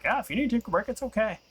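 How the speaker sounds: a light mock of the kind brother; imitating brother. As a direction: reassure casually about the break.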